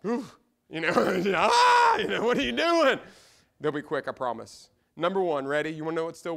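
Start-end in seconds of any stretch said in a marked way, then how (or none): 0.1-3.0 high, loud, hammed-up wail; comic exaggerated shriek, easing into a raised-voice question; wife's mock alarm. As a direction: imitate a wife crying out in dismay. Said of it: "I told my wife that this morning. She's like".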